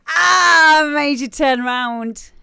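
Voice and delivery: loud, high triumphant taunt; gleeful gotcha. Retold or imitated. Pupil gloating at classmates who fell for it. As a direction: crow over catching them out. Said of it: "And then it would be me, like".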